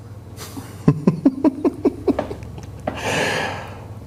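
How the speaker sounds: high breathy laugh. Real, not acted. Laughs at pitch football.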